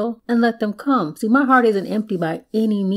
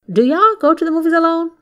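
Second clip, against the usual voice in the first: much higher, louder mock poll; calling out a question; 10 semitones higher, 5 dB louder than usual. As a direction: pitch it high and put it to the whole crowd. Mock poll of single fellas.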